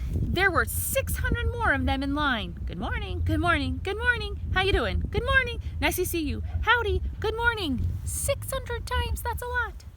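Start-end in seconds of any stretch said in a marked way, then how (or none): 2.6-7.9 high, cheerful little greeting; high chirpy worm hello. Acted. Worm greeting many ants. 8.1-9.9 amazed. Real, not acted. High slow amazed remark.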